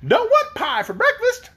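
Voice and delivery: put-on very high-pitched small child's voice; squeaky-high little kid; little boy. A narrator's reading. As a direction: pipe up like a wee boy making a demand.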